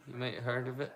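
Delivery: usual speaking voice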